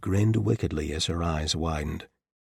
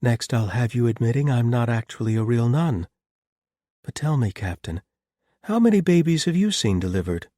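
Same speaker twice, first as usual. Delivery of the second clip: smiling, teasing woman's voice; Clara teasing; 6 semitones higher, 6 dB louder than usual. A narrator's reading. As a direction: tease him with a smile. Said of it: "he could hear the smile in her voice".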